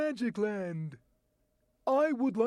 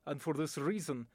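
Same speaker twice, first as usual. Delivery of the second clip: "and for this reason" in a low, grave Russian accent; 4 semitones lower, 5 dB quieter than usual. Shown as a demonstration. A Russian statesman says "and for this reason" in a Russian accent. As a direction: say "and for this reason" like a stern Russian official, falling at the end. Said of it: "my voice talent demonstration".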